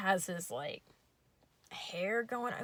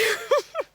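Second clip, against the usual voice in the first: high shrieking laugh; 10 dB louder than usual. Real, not acted. Laughing mid impression.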